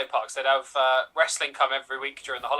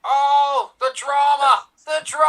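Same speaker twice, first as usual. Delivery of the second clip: wrestling commentator; 10 semitones higher, 6 dB louder, much slower than usual. Pretend. Reacting to dramatic kick-out.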